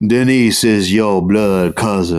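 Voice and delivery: slow, foppish Southern voice; foppish Southern drawl. Retold or imitated. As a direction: drawl it out like a fussy dandy. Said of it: "the foppish fucking Southern guy is like".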